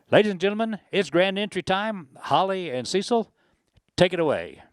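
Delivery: loud, high grand entry call; announcer call. Real, not acted.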